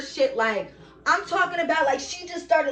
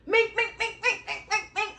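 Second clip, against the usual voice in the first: punch noises; 9 semitones higher than usual. Retold or imitated. Imitating brother's punches.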